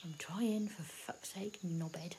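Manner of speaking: very soft irritated mutter; whispered insult; whispered grumble. Acted. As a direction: grumble under your breath so it barely carries. Muttered insult while keeping quiet.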